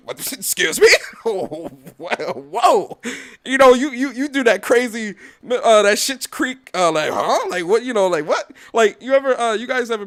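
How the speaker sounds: loud, high, laughing outrage; loud disbelief. Real, not acted. Reacting to the brother's demand, loud disbelief. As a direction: blurt it out in stunned disbelief.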